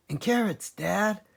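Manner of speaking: a little cub piping up; small fox cub. A narrator's reading. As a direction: smallest fox asking his dad.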